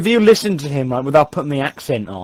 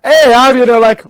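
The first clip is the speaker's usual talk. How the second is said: loud, raised-pitch outburst as Jamie; Jamie impression; 6 semitones higher, 7 dB louder than usual. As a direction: call out in a character's higher voice. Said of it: "Look at Jamie's".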